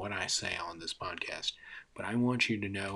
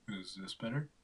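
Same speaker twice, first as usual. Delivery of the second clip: quieter, testing a new mic voice; softer voice try; 7 dB quieter than usual. Shown as a demonstration. Podcaster testing his voice, quietly asking. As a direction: try the line again, more hushed.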